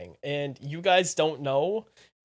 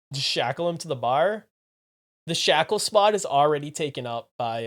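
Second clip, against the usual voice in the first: ordinary talk, nothing marked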